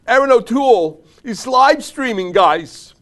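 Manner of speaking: high, loud announcement in an odd put-on accent; hammed-up frantic excitement. Mock viewer telling pals about the livestream, hammed-up frenzy. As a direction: announce it like a giddy fan. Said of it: "I don't even know what accent that was".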